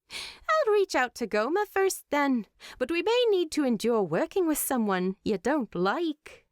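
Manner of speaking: Freya. A narrator's reading. Freya voiced in story dialogue.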